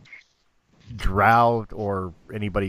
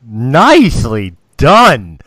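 excited praise; 14 dB louder, much slower than usual. Real, not acted. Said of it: "I gotta break character".

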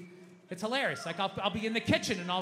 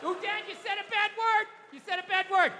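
put-on high little kid voice; cute child voice; 10 semitones higher, 4 dB louder than usual. Daughter catching dad swearing, cute child voice. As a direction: sing out a child's scolding. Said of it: "I hear that cute voice".

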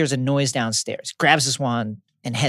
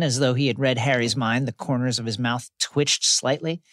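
ordinary talk

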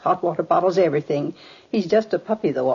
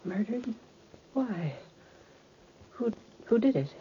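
hushed, halting disbelief; stunned grief; 4 semitones higher, 10 dB quieter than usual. Acted. Mother hearing her son was murdered, stunned and hushed. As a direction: barely get the questions out, softly.

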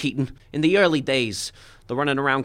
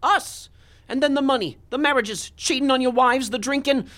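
angry outburst; 9 semitones higher than usual. Acted. Comedian blasting his partner's excesses, furious and high. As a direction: hurl the accusations at him.

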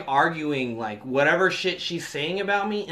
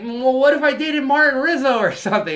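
high, loud mock argument; girlfriend screaming; 7 semitones higher, 5 dB louder than usual. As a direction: mimic a daughter yelling a challenge at mom.

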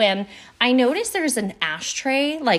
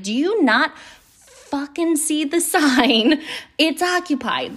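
higher, slow and drawn-out mock fury; angry retort; 6 semitones higher, slower than usual. To someone yanking the occupied door, indignant and higher. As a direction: let the annoyance boil over. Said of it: "now I'm getting angry".

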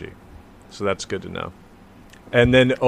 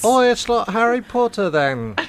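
high-pitched mock British voice; British accent; 10 semitones higher than usual. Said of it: "British people can only compare things".

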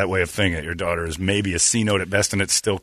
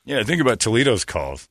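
ordinary talk, nothing marked

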